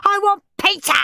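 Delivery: high, loud tantrum yell; shrieking demand. A narrator's reading. Henry rejecting his sandwich for pizza, shrieking. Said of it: "shrieked Henry".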